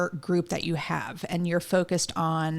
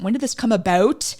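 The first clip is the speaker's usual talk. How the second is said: light Canadian lilt on the question; Canadian accent; 4 semitones higher, 5 dB louder than usual. Shown as a demonstration. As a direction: ask it the Canadian way. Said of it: "let me use my Canadian accent".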